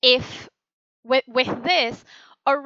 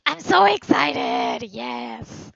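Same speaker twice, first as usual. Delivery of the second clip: hushed triumphant yes at the end; excited; 4 dB louder, much slower than usual. Shown as a demonstration. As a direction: savour the thrill. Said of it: "the other one is".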